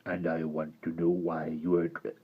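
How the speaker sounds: low, soft gravelly rasp; deep creature voice. Acted. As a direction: keep the hideous creature voice low. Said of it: "said the hideous creature".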